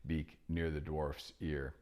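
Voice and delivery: ordinary delivery, nothing marked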